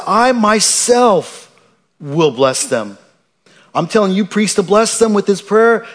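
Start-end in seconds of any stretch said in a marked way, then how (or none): 0.1-3.0 God's emphatic promise, I myself stretched out; stress on I myself. Acted. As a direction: hit I myself hard and slow. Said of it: "emphatic says".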